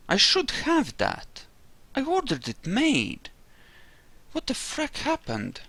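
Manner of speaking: rising, incredulous what; can't believe the gear is gone; baffled disbelief. Real, not acted.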